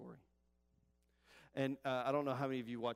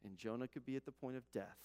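ordinary talk

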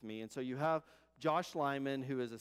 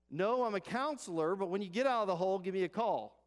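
lightly voiced passerby, louder and higher; raised, brisk acted reply; counselor calling down; 6 semitones higher, 7 dB louder than usual. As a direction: call back down to someone below. Counselor answers man in hole.

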